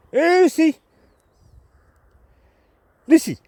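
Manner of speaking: loud high call across the woods. Real, not acted. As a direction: shout the dog's name from afar. Calling the dog from afar.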